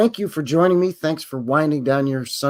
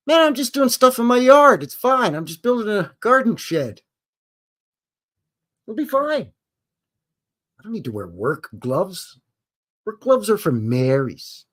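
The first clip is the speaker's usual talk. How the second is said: mocking macho guy; 7 semitones higher than usual. Careless guy brushing off work gloves, mocking high macho voice. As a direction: scoff at the safety gear like a cocky know-it-all.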